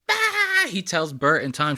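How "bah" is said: high hammed-up shriek; a cartoonish scream of shock; mock startled shriek. Host mimicking the masseuse's shocked shriek. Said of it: "and she goes".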